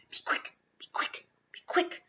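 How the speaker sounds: whispered, hurried urging to himself; a tense inner whisper; urgent whisper. A narrator's reading. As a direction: breathe it out under the breath. Boy urging himself silently.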